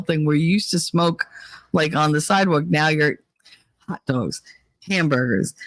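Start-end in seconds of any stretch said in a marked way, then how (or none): none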